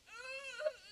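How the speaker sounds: drawn-out, high-pitched mock "ugh"; high dismayed groan. Teenager finding him waiting outside the bathroom, dismayed groan.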